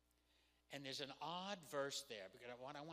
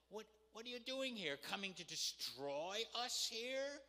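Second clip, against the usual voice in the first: higher-pitched, incredulous questioning; indignant challenge; 6 semitones higher than usual. Acted. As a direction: challenge him as an affronted accuser. Acted challenge from the foul-mouthed man.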